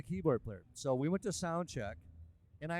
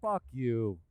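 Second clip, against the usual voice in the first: a louder mock snarl, voice turned rough; defiant retort; 7 dB louder than usual. Keyboardist turning to rival [name], louder mock defiance. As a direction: throw it back at him, cocky. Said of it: "I looked at him, and I went".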